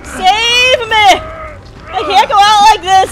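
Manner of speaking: loud plea. Pretend.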